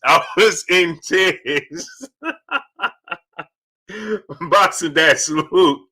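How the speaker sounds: laughing through the words; can't stop laughing while talking; real laughter breaking up the words. Real, not acted. Laughing recalling the joke.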